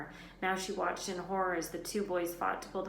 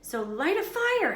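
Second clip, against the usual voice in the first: choked; 6 semitones higher, 8 dB louder than usual. A narrator's reading. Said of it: "Harry choked".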